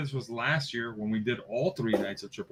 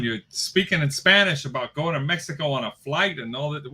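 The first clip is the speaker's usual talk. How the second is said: ordinary delivery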